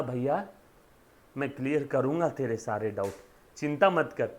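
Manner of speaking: calm him down gently; mock reassuring. Teacher reassures caller in story.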